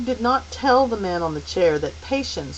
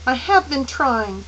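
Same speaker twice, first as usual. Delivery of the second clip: put-on higher, anxious boy voice; a worried child straining; high and troubled; 6 semitones higher, 4 dB louder than usual. A narrator's reading. Charles Wallace to the thought, high and troubled. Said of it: "his voice high and troubled".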